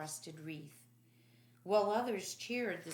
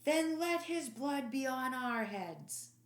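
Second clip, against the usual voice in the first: higher, slow chanting crowd voice; crowd shouting; 7 semitones higher, 5 dB louder than usual. Acted. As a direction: roar it back as the mob.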